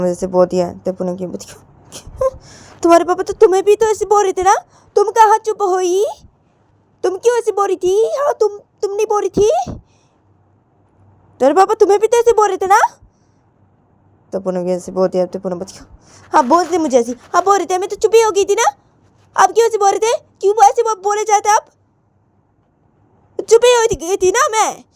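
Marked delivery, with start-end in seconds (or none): 2.8-12.8 high-pitched, loud retort; accusing mother. Acted. Mother telling daughter she was scolded too, accusing. 16.3-24.8 high, loud, heated protest; Poonam, defensive. Acted.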